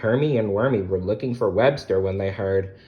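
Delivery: usual speaking voice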